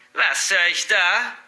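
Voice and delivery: mocking cackly granny voice; cackling grandmother. A narrator's reading. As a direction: draw it out like a gloating old woman. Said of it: "gackerte sie".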